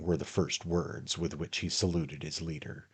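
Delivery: ordinary delivery, nothing marked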